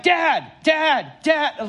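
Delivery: put-on quick, high, insistent toddler calls; impatient little boy calling out; rapid calls for Dad. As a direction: fire off the dads with no gaps. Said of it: "not, like, a long pause between the dads".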